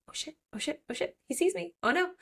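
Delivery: a playful hint of rising panic; mock panic. Odessa spots the Guardian.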